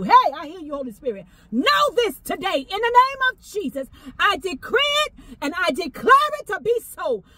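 ordinary talk, nothing marked

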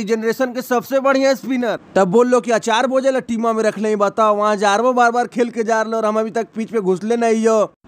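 normal talking voice

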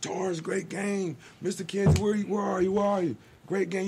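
imitating a coach bursting in, calling for the grandfather; excited mock yelling; retold yelling. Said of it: "He come in yelling".

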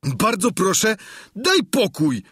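high-pitched squire voice, pleading to be left alone; indignant high-voiced servant; Sancho, protesting. A narrator's reading. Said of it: "odrzekł Sancho".

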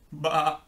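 imitated farmyard baa; sheep bleat. A narrator's reading. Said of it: "said the sheep".

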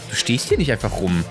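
normal talking voice

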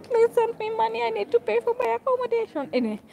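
high, squeaky mock plea; mock begging. Vlogger jokingly begging viewers to send money, high mock whine. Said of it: "begging viewers for money".

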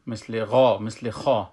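ordinary talk

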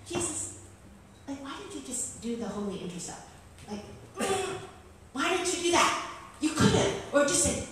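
high-pitched playful protest; mock exasperation. Teacher playfully challenging Jesus, exasperated. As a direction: complain upward in a high, incredulous voice.

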